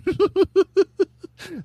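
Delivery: loud high villain cackle; Joker cackle. Acted. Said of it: "You know, it's like".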